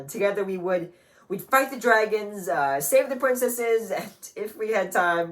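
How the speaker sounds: loud, high, eager tale of adventures; excited recollection. Acted. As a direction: build up the childhood games with glee. Recalling heroic childhood games.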